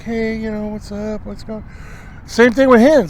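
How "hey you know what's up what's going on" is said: much softer, fading almost to nothing; soft hen talk. Retold or imitated. Feeding hens talking to each other, in a soft murmur. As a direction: murmur it like hens chatting while they feed. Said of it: "They're just soft talking".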